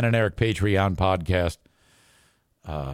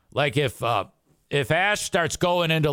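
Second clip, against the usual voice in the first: ordinary talk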